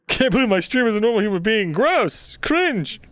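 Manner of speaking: high-pitched, hurried mock outrage; mock disgust. Streamer teased for being normal, high mock disgust. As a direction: squeal it upward in pretend revulsion.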